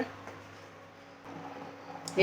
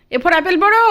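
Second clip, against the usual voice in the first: much higher, louder, পড়ো rising sharply; insistent reading prompt; 9 semitones higher, 7 dB louder than usual. Real, not acted. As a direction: push harder on পড়ো. Repeats prompt more insistently.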